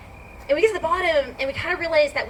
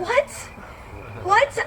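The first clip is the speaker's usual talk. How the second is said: shocked what; 8 semitones higher than usual. Retold or imitated. Reacting to the price.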